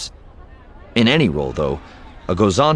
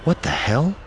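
stunned; 8 semitones higher than usual. A narrator's reading. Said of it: "he trailed off".

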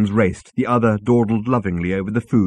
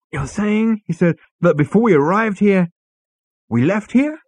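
high, louder disbelief; incredulous; 12 semitones higher, 4 dB louder than usual. A narrator's reading. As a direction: spell out the absurdity. Zaphod incredulous.

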